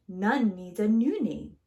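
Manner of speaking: usual speaking voice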